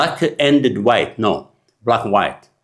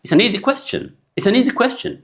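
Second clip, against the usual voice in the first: much higher, "an" reduced; high demo line; 13 semitones higher, much slower than usual. Shown as a demonstration. Teacher repeating the example line. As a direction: lift it up and glide through "an". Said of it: "We don't say".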